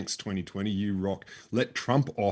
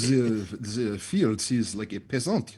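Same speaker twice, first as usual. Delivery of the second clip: mock French snob; much slower than usual. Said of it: "Parisians loved rustic American".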